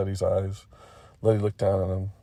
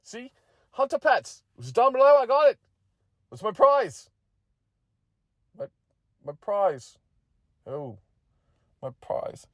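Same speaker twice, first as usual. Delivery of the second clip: high-pitched little champion, crowing then fading; excited bingo winner McGeady; 13 semitones higher than usual. Acted. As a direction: show off your win in a high voice.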